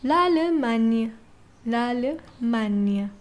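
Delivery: l'Allemagne said slowly; careful teacher model; slow demo. Shown as a demonstration. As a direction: draw out l'Allemagne syllable by syllable. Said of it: "How do you say Germany in French?".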